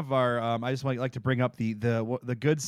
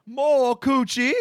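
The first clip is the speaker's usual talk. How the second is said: much higher, slow, louder pleading; Oliver Twist pleading; 12 semitones higher, 8 dB louder, much slower than usual. Retold or imitated. Orphan asking again for more, high slow plea. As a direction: stretch it out like a begging orphan. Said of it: "Just coming up like Oliver Twist".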